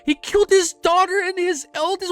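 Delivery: breaking into a pained, high wail; real grief, near crying. Real, not acted. Streamer reading a tragic chapter to chat, wailing in real grief. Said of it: "man, I'm crying".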